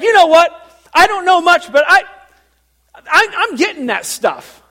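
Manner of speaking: high, loud, brash parishioner; Teresa impression. Churchgoer reacting to the sermon, loud high impression. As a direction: imitate an outspoken South Side gal at full volume. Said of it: "That's exactly how she talks".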